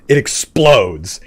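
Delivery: ordinary delivery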